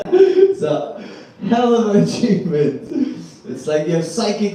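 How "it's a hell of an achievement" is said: high and loud, breaking into laughter; can't hold it together as you repeat it; laughing through it. Real, not acted. Repeats punchline while cracking up.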